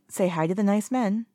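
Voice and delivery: put-on lifted, sing-song talk to a baby; sweet baby voice. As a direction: coo it to the baby. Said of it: "in a sweet baby voice".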